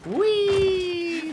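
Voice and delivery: high, loud, playful whee; gleeful whee. Real, not acted. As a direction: squeal like on a slide. Jumping down in game.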